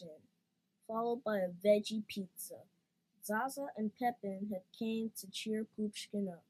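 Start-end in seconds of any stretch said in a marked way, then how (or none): none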